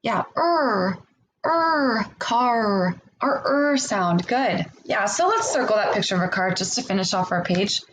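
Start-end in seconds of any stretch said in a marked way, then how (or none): 0.0-4.3 er stretched and said apart from car; exaggerated word endings. Shown as a demonstration. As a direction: pull the er ending out long. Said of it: "what is the ending sound that you hear in the word car?".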